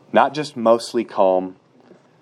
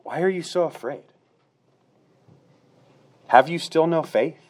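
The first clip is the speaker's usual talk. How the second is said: Jesus rebuking; 7 semitones higher than usual. Pretend. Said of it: "he said to them".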